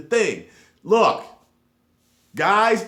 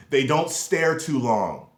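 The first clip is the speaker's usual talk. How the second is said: ordinary talk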